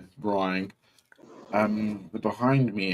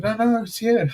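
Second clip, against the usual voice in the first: much higher no, no, it's here; high insistence; 14 semitones higher, 4 dB louder than usual. Retold or imitated. Telling the man in the queue where the money is, high and insistent.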